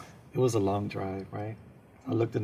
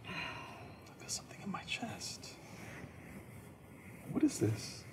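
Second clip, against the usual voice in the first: worried hush; 6 semitones higher, 14 dB quieter than usual. Retold or imitated. His worried inner thought.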